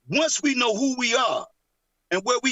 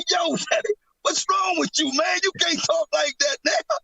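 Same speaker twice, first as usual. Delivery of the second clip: higher-pitched retold son scolding his dad; objecting son; 6 semitones higher than usual. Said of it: "So my son".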